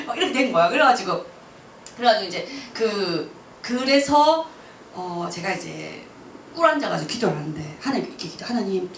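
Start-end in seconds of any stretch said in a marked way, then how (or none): none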